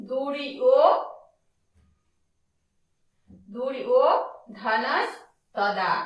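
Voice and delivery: দুরি ও ধনস তদা broken into separate pieces with pauses; teacher spelling out the name by sound; syllable breakdown. Shown as a demonstration. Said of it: "তাহলে কি হলো?".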